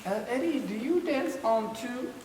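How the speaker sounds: quizzing dancer. Retold or imitated. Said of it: "guys coming up to me... asking me".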